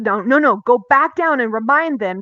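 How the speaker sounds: loud urgent no, no, no; loud warning. Retold or imitated. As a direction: call them back down firmly and loud. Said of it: "he says".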